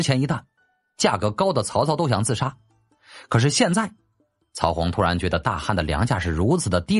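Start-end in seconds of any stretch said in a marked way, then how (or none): none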